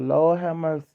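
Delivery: ordinary talk, nothing marked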